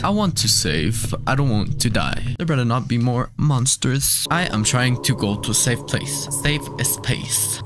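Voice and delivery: normal talking voice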